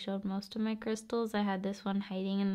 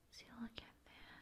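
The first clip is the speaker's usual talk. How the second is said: ASMR whisper; 9 dB quieter than usual. Acted. ASMR host whispering to viewer to relax face. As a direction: whisper to calm the listener.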